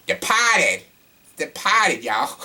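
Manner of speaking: high, hammed-up Boston accent; terrible Boston accent. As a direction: call it out in bad Bostonian, R dropped. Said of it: "my terrible Boston accent".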